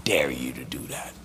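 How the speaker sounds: low whispered taunt of the devil; devil's whisper. Acted. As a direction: tempt them in a hushed whisper. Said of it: "The devil will dare you".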